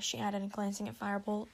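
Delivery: ordinary delivery, nothing marked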